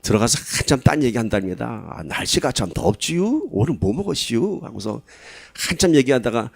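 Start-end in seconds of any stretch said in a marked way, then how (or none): 1.9-4.6 slow, easygoing Chungcheong dialect; a light imitation of a Chungcheong neighbour making small talk; 느릿한 충청도 사투리 흉내; Chungcheong drawl. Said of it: "충청도 사람이 그런다".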